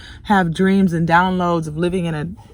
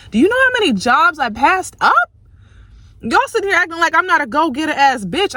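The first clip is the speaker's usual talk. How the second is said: raised, fast, fed-up rant; indignant high rant; exasperated rant; 7 semitones higher, faster than usual. Real, not acted. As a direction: vent hard at the viewers. Ranting at her viewers.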